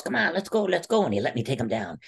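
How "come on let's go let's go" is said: encouraging coach. Retold or imitated. Coach teasing the wrestler he just toyed with, high and coaxing. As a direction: coax him up high, half teasing. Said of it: "He goes".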